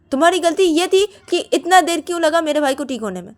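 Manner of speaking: high, loud accusing tirade; angry accusation. Acted. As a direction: accuse her in one loud run. Shruti accuses Siya.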